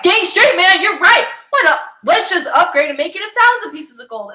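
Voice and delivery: loud high eager king voice; greedy king. Retold or imitated. The king eagerly agreeing with the lamp, loud and high. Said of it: "And the king is like".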